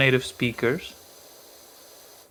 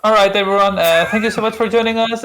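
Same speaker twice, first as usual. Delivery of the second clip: announcer voice; 9 semitones higher, 7 dB louder, faster than usual. Pretend. Fake stream sign-off.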